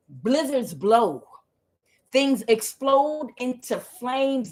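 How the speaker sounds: slow, weighty prophetic delivery; slow spell-like intoning; dramatic proclamation. Acted. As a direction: pronounce each disaster like a prophet.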